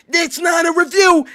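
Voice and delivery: loud, high angry shout. Real, not acted. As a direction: yell the point home. Shouting conclusion.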